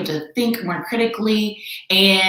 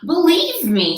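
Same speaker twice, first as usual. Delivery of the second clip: Horton pleading; 10 semitones higher, 3 dB louder, slower than usual. Acted. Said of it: "said Horton".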